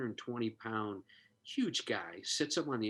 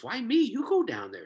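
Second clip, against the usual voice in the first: high, loud indignant "Why me?" pushing "go" away; mock protest; 12 semitones higher, 7 dB louder than usual. Husband refusing his wife, high and loud protest. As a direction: squeal out a reluctant husband's protest.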